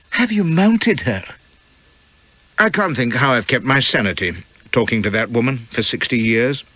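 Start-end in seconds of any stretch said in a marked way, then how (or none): 0.1-1.2 lightly voiced young wife, innocent question; a guileless young wife asking outright; Elspeth's voice. Wife to husband in the dark cabin, lightly innocent.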